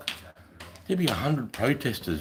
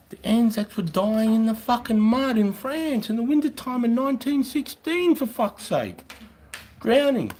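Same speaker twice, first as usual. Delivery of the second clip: worked-up rant. Real, not acted. Heated rant about Anzac suffering.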